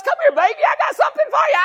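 high coaxing pet-talk voice; God coaxing us like a pet owner, high and sweet; coaxing call. Retold or imitated. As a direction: coo up high and quick like calling a dog. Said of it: "Kinda like God with us".

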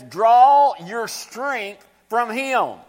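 drawn-out, emphatic verse reading; slow scripture reading. Acted. Preacher reading the verse slowly to the church. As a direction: stretch every word of the verse.

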